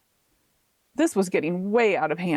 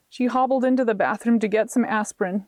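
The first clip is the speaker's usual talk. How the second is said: ordinary delivery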